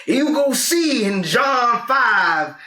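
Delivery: high, slow know-it-all churchgoer; churchgoer impression. Mocking churchgoers who quote chapter and verse, high and slow. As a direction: drawl it like a smug churchgoer. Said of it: "people who go to church and they're like".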